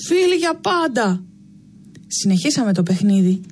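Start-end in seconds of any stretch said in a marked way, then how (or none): 0.1-1.2 higher and slow, a rallying cry; shouted slogan. A narrator's reading. The children raise joined fists and shout their motto, slow high cheer. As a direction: call out the friends' motto together. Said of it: "φωνάξαμε το συνθηματικό μας".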